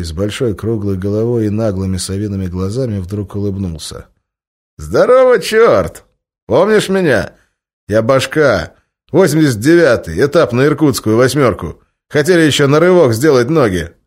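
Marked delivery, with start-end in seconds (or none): 4.8-13.9 loud, high, hearty ex-con; loud greeting. Acted. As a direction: call out boisterously across the bar. Ex-con greets stranger loudly.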